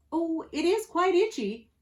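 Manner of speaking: high, prim would-be pirate; proper-spoken rascal. A narrator's reading. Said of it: "answered the man".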